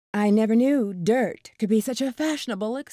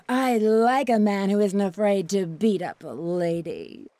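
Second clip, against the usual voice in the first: normal talking voice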